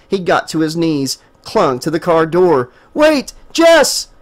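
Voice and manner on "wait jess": high, loud pleading cry; desperate yell. A narrator's reading. Desperate yell. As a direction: Stephen begs Jessica not to drive off. Clinging to car door calling.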